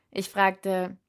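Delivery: usual speaking voice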